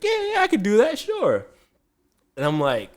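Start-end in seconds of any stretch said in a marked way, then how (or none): 0.0-1.4 very high, eager barber voice; imitating Ray. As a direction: chirp it like he's happy to help. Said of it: "he's like".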